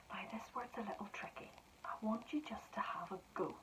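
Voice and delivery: soft hushed whisper to the class. Real, not acted. Teacher whispering a hint to pupils during dictation. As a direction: lean in and whisper a tip.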